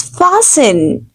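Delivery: fasten with a silent t, "fa-sen"; correct fasten. Shown as a demonstration. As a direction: leave the t out of fasten. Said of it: "T will be silent".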